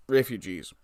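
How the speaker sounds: ordinary talk, nothing marked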